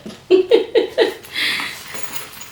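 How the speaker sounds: light giggle, high and airy; high breathy laugh. Real, not acted. Laugh heard.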